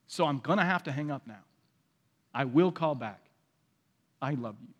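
a quiet firm boundary; gentle resolve fading softly to the end; firm but loving. Retold or imitated. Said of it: "a justice".

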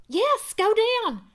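high, loud, slow insistent urging. Acted. Wife urging her husband downstairs, loud and insistent. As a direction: push him out the door. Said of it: "she urged".

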